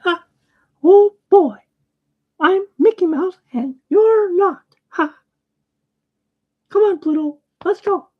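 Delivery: very high squeaky cartoon falsetto; Mickey Mouse. Shown as a demonstration. Demonstrating a famous cartoon mouse for viewers, squeaky falsetto impression. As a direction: pipe it up high and loud, with little squeaky laughs. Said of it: "I can do Mickey Mouse".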